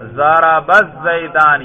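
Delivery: ordinary delivery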